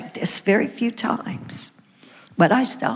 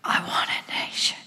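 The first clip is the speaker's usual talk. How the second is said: soft whispered longing; whispered plea; 9 dB quieter, faster than usual. Retold or imitated. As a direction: breathe the plea out softly. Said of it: "I sobbed and I worshiped and I said".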